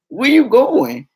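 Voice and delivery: high, loud and slow; slow stunned where you going; shocked question. Retold or imitated. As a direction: drag it out in disbelief. Shocked he's taking the truck.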